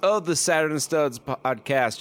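high, slow, hammed-up podcast host; dulcet announcer voice. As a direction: announce it like a grand radio host. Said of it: "those dulcet tones".

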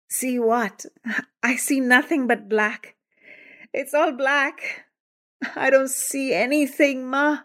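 put-on a blinded teen crying out to his mother in panic; rising panic. A narrator's reading. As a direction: sound the terror of going blind. Kevin realizes he is blind.

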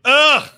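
high, loud mock groan of disgust. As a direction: groan like a viewer put off at once. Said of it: "immediately people are like".

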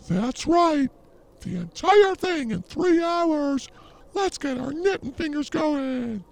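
high, slow, sugary hostess voice; mock podcast host Felicia. Mocking a podcast he found, high prissy host voice. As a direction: parody a chirpy knitting show host. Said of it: "Some shit like that".

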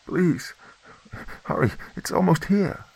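high desperate begging; urgent plea. A narrator's reading. Woman begging the girl to hurry, afraid. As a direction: hurry them with fear.

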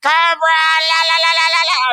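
high, loud villain shriek; Cobra Commander shout. Retold or imitated. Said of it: "Was he being voiced by Chris Latta".

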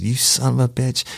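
retold angry outburst, pitched high; mad detective. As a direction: imitate a furious colleague cursing at you. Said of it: "that detective was so fucking mad".

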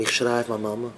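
ordinary talk

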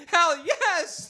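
high desperate yes; high eager shout. Acted. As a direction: blurt it, desperate. Desperate answer.

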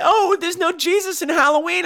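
high, mocking imitation of churchgoers; mocking prissy voice. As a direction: parody the pious complainers, way up high. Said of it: "They're like".

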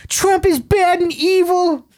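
high, slow mocking parody voice; mock sincere voice. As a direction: parody a preachy liberal in a high voice. Mocking a political opinion.

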